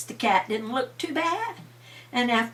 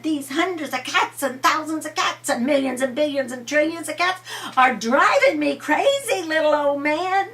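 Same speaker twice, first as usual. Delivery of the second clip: put-on fed-up old lady; 7 semitones higher, 5 dB louder than usual. A narrator's reading. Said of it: "and she said".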